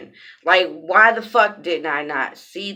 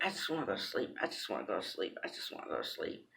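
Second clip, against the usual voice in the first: much softer, fading to a near-whisper; mumbling like she can barely stay awake; sleepy murmur; 11 semitones lower, 20 dB quieter, much faster than usual. Retold or imitated. Retelling her drugged plea to sleep, in a faint murmur.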